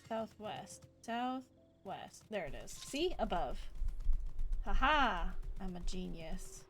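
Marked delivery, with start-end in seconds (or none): none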